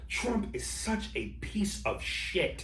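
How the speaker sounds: slow, drawn-out disgusted verdict, each word laid down. Retold or imitated. Recalling his own reaction to Trump, slow disgusted verdict. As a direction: let the contempt drag out slowly.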